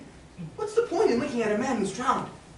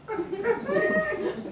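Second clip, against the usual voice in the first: a burst of high disbelieving laughter; incredulous laugh. Acted. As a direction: laugh him off, high and sudden. Scoffing at the offer.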